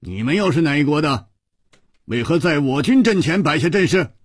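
quick, firm soldier's challenge; mild challenging shout. A narrator's reading. Soldier challenges strangers before his army's line, mildly shouted. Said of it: "一个人喝道".

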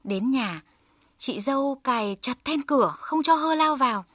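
usual speaking voice

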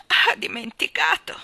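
high, loud lament; acted tearful wail; sob in the throat. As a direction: cry it out with a catch in the throat. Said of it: "con un singhiozzo nella gola".

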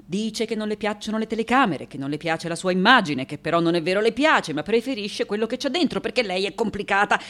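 fast, run-on interpreter's summary; brisk, impatient relaying; hurried translation. Acted. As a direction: rattle through someone else's rant. Weary interpreter translates the rant.

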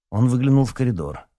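usual speaking voice

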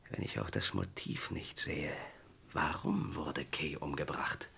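hushed, slow whisper. Acted. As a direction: breathe the question out, slow and unvoiced. Private brooding over the murder.

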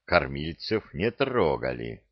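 ordinary delivery

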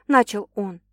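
usual speaking voice

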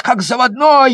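usual speaking voice

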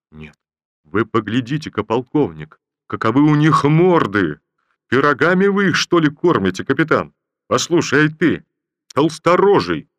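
loud, good-humoured general teasing the captain; jovial general. Acted. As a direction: tease the ranks from the saddle. General jokes loudly at soldiers.

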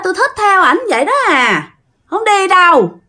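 put-on high, affected girly voice; coquettish girl. Ghost girl refusing to leave the guy, coy and high. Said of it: "theo kiểu cái con gái mà điệu á".

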